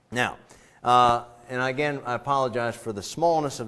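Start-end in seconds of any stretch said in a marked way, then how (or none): none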